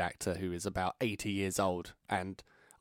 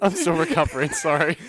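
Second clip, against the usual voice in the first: breathless from laughing; 6 semitones higher, 8 dB louder than usual. Real, not acted. After laughing fit.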